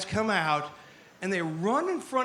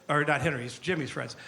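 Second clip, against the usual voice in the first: ordinary talk, nothing marked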